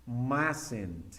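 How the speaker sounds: mustn't said as "masent", first t silent; mustn't pronunciado "másent"; pronouncing mustn't. Shown as a demonstration. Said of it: "la pronunciación, más o menos sería así".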